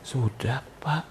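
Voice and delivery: small, soft imitation of a shy maid; demure Javanese maid. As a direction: answer meekly and softly, imitating the maid. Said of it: "Yang satu lagi orang Jawa". (pembantu Jawa, pelan dan santun)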